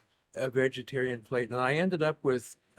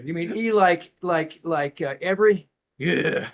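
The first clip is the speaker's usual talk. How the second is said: mock loud phone clarifying; 4 semitones higher, 7 dB louder than usual. Spelling back and forth retold.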